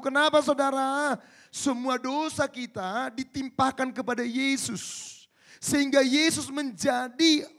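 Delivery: loud, high, slow preaching; fiery preaching. Acted. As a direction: drive it home from the pulpit. Preaching climax. (berkhotbah berapi-api)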